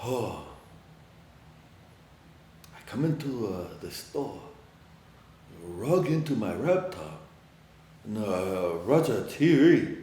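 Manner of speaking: a hint of a quiet old regular describing his day; old man's voice. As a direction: lightly voice the old man's routine. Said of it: "To him, he's like".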